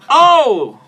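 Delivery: sudden loud high startled cry. Real, not acted. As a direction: jump at a crash. Something falls in the studio.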